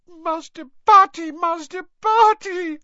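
high, loud sobbing wail; Winky. A narrator's reading. Winky through her hands to Master Barty, sobbing. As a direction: wail the name like a distraught little elf. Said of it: "sobbed Winky through her hands".

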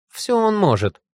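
very high snippy retort; caustic. A narrator's reading. Ёлка contradicting Феликс, caustic high voice. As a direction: insist pertly, pitched high. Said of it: "язвительно отозвалась Ёлка".

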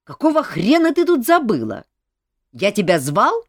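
angry rebuke. Acted. King angrily rebukes the duchess.

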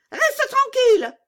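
very high, loud scolding; bossy robber girl. Acted. Robber girl silencing Gerda, very high and bossy. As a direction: order it in a squeaky bandit-child voice.

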